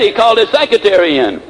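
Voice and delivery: ordinary talk, nothing marked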